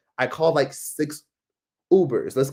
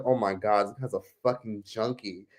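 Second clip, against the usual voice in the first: low, quiet inner thought; dismayed aside; 4 semitones lower, 7 dB quieter than usual. Retold or imitated. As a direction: drop it under your breath in disbelief. Retelling her private alarmed thought.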